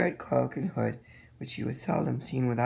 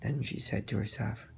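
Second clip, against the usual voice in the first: ordinary delivery, nothing marked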